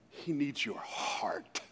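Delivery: slow, very soft near-whisper; hushed earnest whisper. Real, not acted. Preacher telling congregation what God needs, hushed whisper. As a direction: drop to a slow whisper to land it. Hushed climax of sermon point.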